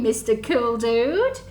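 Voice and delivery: usual speaking voice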